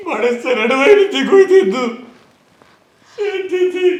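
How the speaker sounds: half-laughing complaint. Pretend. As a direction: laugh while grumbling about crying. [name] laughs through fake grief.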